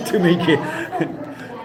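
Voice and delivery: slow words broken by laughter; cracking up mid-sentence; laughing. Real, not acted.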